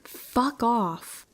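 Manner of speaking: a restrained scream to drive someone off; mild narrated scream. A narrator's reading. Runner screams at the person chasing them, mild yell. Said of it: "I scream at him".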